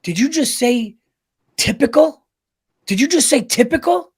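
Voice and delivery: wife demanding did you just say typical; mock accusing wife. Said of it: "she comes out and she goes".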